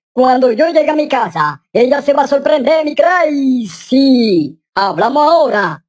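hammed-up thin-voiced man. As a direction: keep the voice thinned out, stretching the nickname. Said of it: "le ha gustado mi voz más fina".

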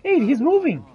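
high, loud startled shout; surprised shout. Real, not acted. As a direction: blurt it out as he starts to move. NPC suddenly moves in game.

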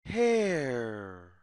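ordinary delivery

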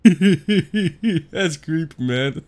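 real laugh through "That's creepy, man."; slow words broken up by laughter. Real, not acted. Laughing at creepy ghost answer.

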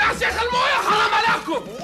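high yell broken by laughter; laughing shout. Acted. Soaked, shouting protest. (صراخ مع ضحك)

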